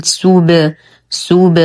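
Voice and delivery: bounced ب in سوب, lips released from the rounded u; qalqalah on ba. Shown as a demonstration. Teacher modelling the ba for students, echoing qalqalah on سوب. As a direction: say سوب with a short echoing release on the ب, no vowel after. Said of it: "نقلقل الباء بالرجوع عن الضم".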